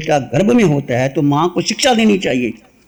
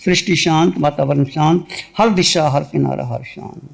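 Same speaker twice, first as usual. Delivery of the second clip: low, calm meditation voice with long pauses; guided meditation; 3 semitones lower than usual. Real, not acted. Teacher leading listeners into meditation, calm and low. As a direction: guide them inward in low, unhurried phrases.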